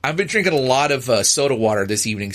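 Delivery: ordinary talk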